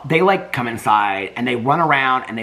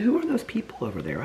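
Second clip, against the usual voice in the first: puppy voice; 10 semitones higher, 6 dB quieter than usual. Pretend. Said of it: "He said".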